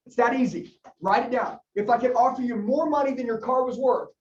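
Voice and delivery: ordinary talk, nothing marked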